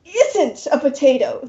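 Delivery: a demon straining to stay polite; between his teeth. Acted. Demon to player, seething through gritted teeth. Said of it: "suggests between his teeth".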